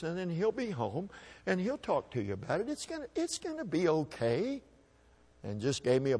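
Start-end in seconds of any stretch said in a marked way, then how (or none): 0.0-4.6 soft, high comforting voice; hushed reassurance from a big brother; gentle comfort. Retold or imitated. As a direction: soothe a crying kid, softly. Brother comforting crying boy.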